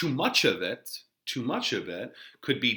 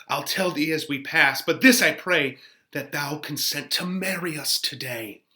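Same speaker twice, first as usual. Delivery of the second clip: Romeo in love; 3 semitones higher, 5 dB louder than usual. Acted. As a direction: pour it out, pleading for the wedding. Reads Romeo's part.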